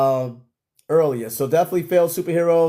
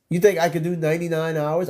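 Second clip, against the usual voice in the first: ordinary delivery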